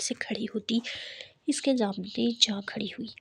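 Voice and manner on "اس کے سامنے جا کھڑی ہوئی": usual speaking voice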